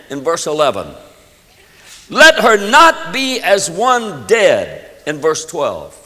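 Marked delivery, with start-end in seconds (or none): 2.1-4.9 slow, higher-pitched desperate plea; desperate cry. Acted. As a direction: plead desperately, drawing it out. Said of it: "It was a desperate cry".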